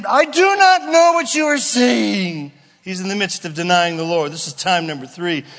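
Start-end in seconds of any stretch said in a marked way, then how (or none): 0.1-2.5 Peter's denial. Acted. Peter denying the Lord.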